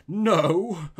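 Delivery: loud no breaking into laughter; laughing cry. A narrator's reading. Robber scoffs at the priest's refusal, laughing. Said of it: "laughed low but long".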